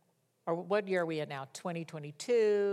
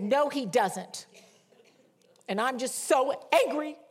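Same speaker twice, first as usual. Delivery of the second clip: re-enacted tantrum; 5 semitones higher, 3 dB louder than usual. Mother retelling her argument with her daughter, mock tantrum. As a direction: replay your own sulky refusal. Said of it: "I was just having a tantrum".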